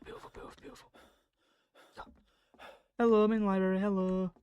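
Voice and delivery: normal talking voice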